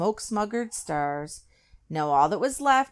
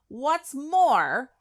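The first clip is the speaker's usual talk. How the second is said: higher snapped retort; 9 semitones higher, 4 dB louder than usual. A narrator's reading. Lorax snaps at the Onceler. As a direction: snap it back at him. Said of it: "snapped the Lorax".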